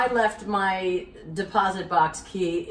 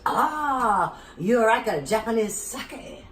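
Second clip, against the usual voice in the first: slow, put-on Japanese accent; mock Japanese accent; 3 semitones higher, much slower than usual. Owner asking about sake, slow mock Japanese accent. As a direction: draw it out like the owner. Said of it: "She goes".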